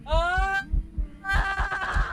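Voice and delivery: sudden scared scream; frightened scream. Real, not acted. Ghost hunt, screams in fright.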